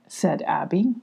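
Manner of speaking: ordinary delivery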